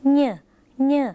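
"เน่อ" as a nasal nyuh; "เน่อ" said alone as a nasal ny sound, as in Isan speech. Shown as a demonstration. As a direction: sound out "เน่อ" through the nose with a ny onset. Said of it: "เป็นเสียงขึ้นจมูก".

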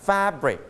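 "fabric" as FAB-ric; stress on first syllable. Shown as a demonstration. Teacher correcting class's stress, FAB-ric in "fabric". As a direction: hit "fab" in "fabric", let "ric" fall. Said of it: "ต้อง stress ที่พยางค์ที่หนึ่ง".

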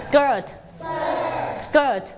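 teacher drilling "skirt"; model pronunciation. Shown as a demonstration. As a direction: say "skirt" crisply for pupils to echo. Teacher models word for repetition.

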